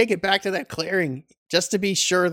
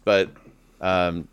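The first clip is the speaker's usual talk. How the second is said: ordinary talk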